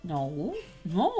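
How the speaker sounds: normal talking voice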